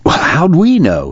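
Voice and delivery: high, drawn-out protest of innocence; reassuring. A narrator's reading. Connie to Rosa Sharon after the death, reassuring. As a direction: reassure her, pitch jumping up slowly. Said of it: "he reassured her".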